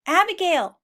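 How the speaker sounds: high call for the cow; yelling. Acted. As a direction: yell across to the tree. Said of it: "he yelled".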